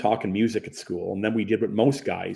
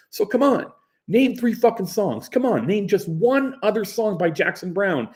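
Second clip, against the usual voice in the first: taunting challenge; 11 semitones higher, 4 dB louder than usual. Retold or imitated. Pressing challenge.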